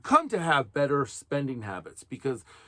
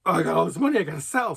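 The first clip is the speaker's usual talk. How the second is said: mock freaking out; 3 semitones higher, 6 dB louder, much faster than usual. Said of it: "freaks out a little bit and says".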